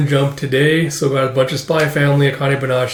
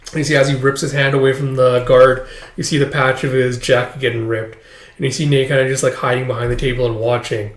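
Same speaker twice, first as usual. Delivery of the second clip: usual speaking voice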